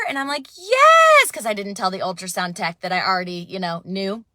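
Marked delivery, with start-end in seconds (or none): 0.6-1.3 high, loud, drawn-out "Yes"; eager yes. Retold or imitated. Mother answering the tech, high and eager. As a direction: leap at the chance. Asked if she wants to know.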